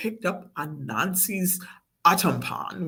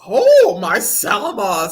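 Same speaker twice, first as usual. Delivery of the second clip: startled Granny; 10 dB louder than usual. A narrator's reading. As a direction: exclaim loudly at a slobbery kiss. Granny reacts to slobbery kiss.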